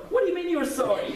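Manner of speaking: loud, high-pitched indignant comeback, played for laughs; mock outrage. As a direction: imitate a hothead rejecting an apology. Acted rejection of an apology.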